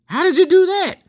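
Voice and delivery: very high mock-astonished squeal; amazed onlookers. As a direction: mimic everyone's disbelief in a squeaky high voice. Voices everybody's amazed reaction.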